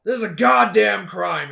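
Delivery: loud, high, indignant yell; outraged shout. Acted. John confronts a looter, outraged shout. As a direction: thunder it in outrage at the crime.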